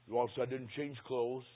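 ordinary talk

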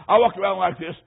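ordinary talk, nothing marked

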